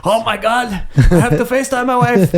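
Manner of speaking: put-on high, loud, laughing Indian accent. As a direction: burst out amazed in an Indian accent. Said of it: "did the Indian accent".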